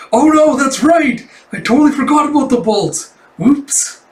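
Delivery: put-on shocked, very high and loud child voice; Anya. Child taken aback on remembering bolts, high shocked squeal. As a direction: squeal in mock horror at forgetting. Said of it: "completely shocked and taken aback".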